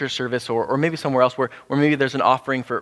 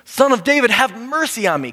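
much higher and louder cry; shouting all the more; 9 semitones higher, 4 dB louder than usual. Retold or imitated. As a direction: shout past everyone telling him to stop. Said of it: "he shouted all the more".

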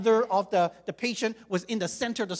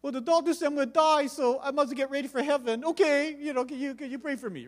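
higher, resigned request; dying man, higher and earnest; husband asking; 5 semitones higher than usual. Retold or imitated. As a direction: ask for prayer, voice lifted. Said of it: "And then he says".

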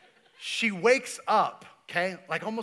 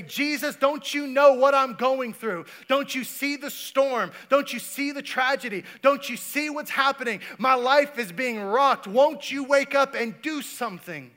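desperate plea; 7 semitones higher, 6 dB louder than usual. Pretend. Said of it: "and you say".

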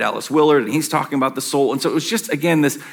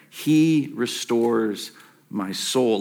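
lower, very slow and softer; slow, soft repeat; 4 semitones lower, 5 dB quieter, much slower than usual. Shown as a demonstration. Pastor pointing the church back to the line, slow and hushed. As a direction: dwell on each word as you repeat it. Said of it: "Now, that line there".

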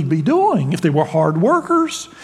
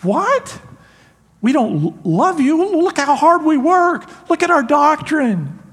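much higher, indignant disbelief; stunned protest; 9 semitones higher than usual. Pretend. Ephesian church protests to Jesus, shocked and indignant. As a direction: sputter like you've been blindsided.

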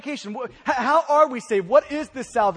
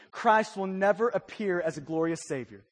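normal talking voice

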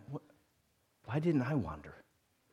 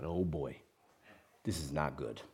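inner thought; 9 semitones lower, 10 dB quieter than usual. Retold or imitated. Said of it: "And I thought".

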